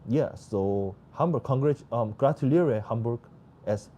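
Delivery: normal talking voice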